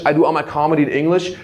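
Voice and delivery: ordinary talk, nothing marked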